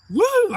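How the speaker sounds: very high, loud "woo"; piercing cheer, reliving it; excited whoop. Retold or imitated. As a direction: whoop like a thrilled spectator. Retelling own cheering.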